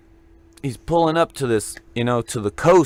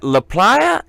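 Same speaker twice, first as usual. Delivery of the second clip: much higher realizing question; 9 semitones higher, 3 dB louder than usual. Retold or imitated. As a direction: ask it as it dawns on you. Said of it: "And I went".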